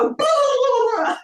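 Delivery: high, drawn-out blah blah, mocking her own chatter; silly high babble making fun of own rambling. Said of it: "'cause I just go".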